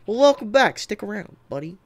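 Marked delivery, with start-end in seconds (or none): none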